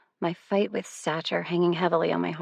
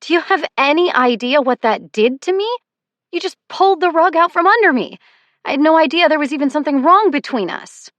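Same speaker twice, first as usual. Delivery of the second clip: higher, louder, furious yelling; furious shouting; 9 semitones higher, 11 dB louder than usual. A narrator's reading. To the ex who cheated on her, furious higher yelling. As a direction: hurl the hurt at him at full voice. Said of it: "I'm so angry my vision blurs".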